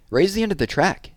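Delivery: a smug little sister giving tips; bossy young girl. A narrator's reading. Sister voiced in read story.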